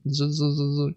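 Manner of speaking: a light buzzing zzz like a robot mower; mower buzz imitation. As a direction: buzz it out like a little machine cutting grass.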